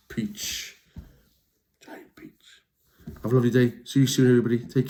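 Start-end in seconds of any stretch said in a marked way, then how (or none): 1.8-2.6 soft whispered echo. Shown as a demonstration. Repeating the book title to children, whisper. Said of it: "whispered echo".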